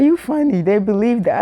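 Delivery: high, loud amused remark; amused teacher. Retold or imitated. English teacher amused by the student's lies, high loud amused. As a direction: say it while cracking up. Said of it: "She said".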